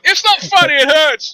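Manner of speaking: husband protesting to laughing wife, whiny. Retold or imitated. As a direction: protest that it hurts, whining. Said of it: "I was like".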